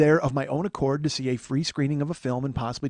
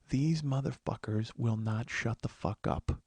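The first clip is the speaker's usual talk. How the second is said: softer, slow, confiding complaint; quiet grumble; 6 semitones lower, 9 dB quieter, slower than usual. Retold or imitated. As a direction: mutter it to your wife only. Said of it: "I thought I said it quietly".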